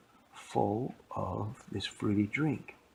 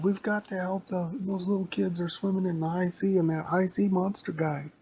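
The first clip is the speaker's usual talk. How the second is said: put-on high, loud urgent child voice; urgent little girl; 9 semitones higher, 4 dB louder than usual. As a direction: insist they must act now. Little girl wants to rescue.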